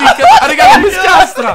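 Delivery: parole tra le risate, più acute; ridendo. Real, not acted. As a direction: parla mentre scoppia a ridere. Ribaltati in gara, risate. (ridendo a crepapelle)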